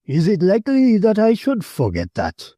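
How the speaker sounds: a touch of scornful ill temper. A narrator's reading. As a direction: snap back with mild scorn. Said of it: "a touch of scornful ill temper".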